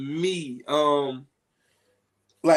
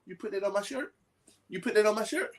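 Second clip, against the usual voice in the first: daughter asking; 6 semitones higher, faster than usual. Retold or imitated. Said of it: "call me in".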